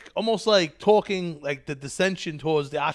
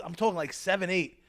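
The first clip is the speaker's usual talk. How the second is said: usual speaking voice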